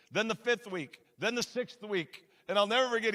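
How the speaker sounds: ordinary talk, nothing marked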